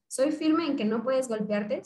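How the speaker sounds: ordinary talk, nothing marked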